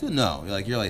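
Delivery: ordinary talk, nothing marked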